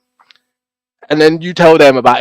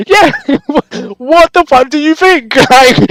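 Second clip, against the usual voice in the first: incredulous laugh; 11 semitones higher, 4 dB louder than usual. Real, not acted. Laughs off a viewer's accusation.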